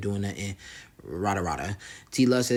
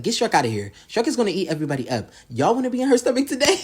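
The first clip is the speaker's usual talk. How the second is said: imitated jeering, climbing high and loud; mocking taunt; 11 semitones higher, 4 dB louder, faster than usual. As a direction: build the taunt up until it breaks into laughter. Retelling Jocelyn's Shrek taunts.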